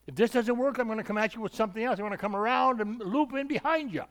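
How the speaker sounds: lightly voiced scheming devil. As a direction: plot your attack like a cunning enemy.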